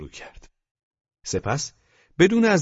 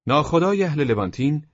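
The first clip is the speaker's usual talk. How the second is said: ordinary delivery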